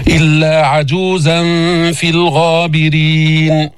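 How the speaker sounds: slow, sustained tajweed recitation; Quran recitation. Shown as a demonstration. Teacher recites the verse for learners, slow recitation. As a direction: stretch the long vowels evenly.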